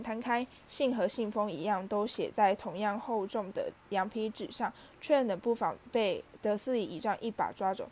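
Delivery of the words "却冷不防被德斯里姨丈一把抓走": ordinary delivery, nothing marked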